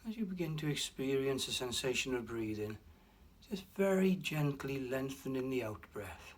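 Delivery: ordinary talk, nothing marked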